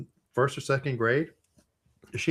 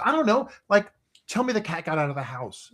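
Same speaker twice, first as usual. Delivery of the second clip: exasperated instruction; 9 semitones higher than usual. Retold or imitated. Brother still upset, instructs.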